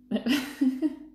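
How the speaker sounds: high cackling villain laugh; evil laugh. A narrator's reading. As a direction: cackle in triumph.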